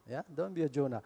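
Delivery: quiet echo of the saying; soft repeat. Shown as a demonstration. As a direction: let "don't be a Jonah" drop away as an afterthought. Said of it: "now there is a common expression".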